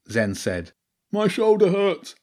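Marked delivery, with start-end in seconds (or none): 1.1-2.1 a high, loud complaint of pain; cried out. Acted. Bobby crying out in pain in the back seat, high and loud. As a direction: cry out about the sore shoulder. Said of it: "Bobby cried".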